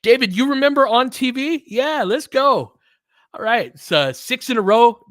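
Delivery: giddy victory shout to the chat; excited cheer. Real, not acted. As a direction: whoop it up as the win seals. Celebrating the win on stream.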